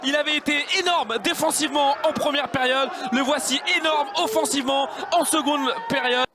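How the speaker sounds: high, excited commentator shout; excited goal call. Real, not acted. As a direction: hail the goal.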